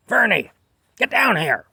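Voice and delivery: high, loud holler to call someone down; imitated yell. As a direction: yell like a bossy supervisor summoning a worker. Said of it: "she yelled at me to come down".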